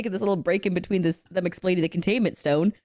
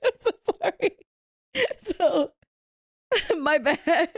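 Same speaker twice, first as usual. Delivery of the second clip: laughing apology; 9 semitones higher than usual. Real, not acted. Retelling apology to fiance while laughing.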